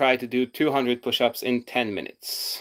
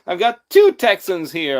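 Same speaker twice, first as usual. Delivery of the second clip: laughing; 6 semitones higher, 5 dB louder than usual. Real, not acted. Amused by second Texan.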